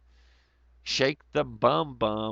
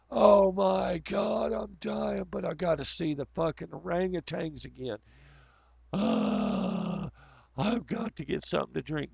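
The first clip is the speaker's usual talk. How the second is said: mock dying zoo visitor; 7 semitones higher than usual. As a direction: ham it up like you're dying of heat on hot pavement.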